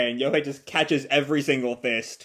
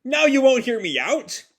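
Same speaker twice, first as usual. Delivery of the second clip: high, indignant yell at his father; hammed-up angry outburst; mock yell; 7 semitones higher than usual. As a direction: protest that he asked first. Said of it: "Nagi yells at Yohei".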